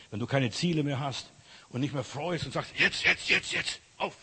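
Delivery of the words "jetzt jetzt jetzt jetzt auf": quick, higher rallying call; urgent urging. Acted. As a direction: urge them to get moving. Acted urging call to get going.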